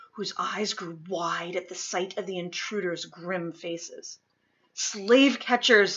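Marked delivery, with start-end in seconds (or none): 4.7-6.0 alarmed. Acted. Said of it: "Luke signed".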